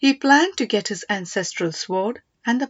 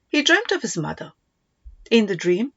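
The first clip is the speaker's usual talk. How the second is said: plain reading voice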